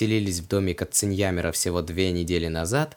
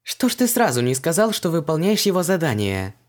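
high, vexed reproach; grumbling; 6 semitones higher than usual. A narrator's reading. Heroine still cross with the demon, grumbling. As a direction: complain at being left uninformed. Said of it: "пробурчала Вон".

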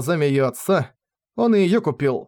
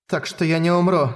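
plain reading voice

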